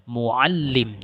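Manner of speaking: "muallim" with weight on "al", then falling; stress on second syllable. Shown as a demonstration. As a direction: lean on the "al" in "muallim". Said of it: "penekanan intonasinya pada suku kata kedua". (tekanan pada "al" dalam "muallim")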